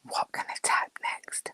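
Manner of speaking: soft whisper, no voice; mock whispered musing; imitating her thoughts. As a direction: whisper as a friend's inner thought. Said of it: "She sat there thinking".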